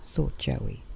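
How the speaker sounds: usual speaking voice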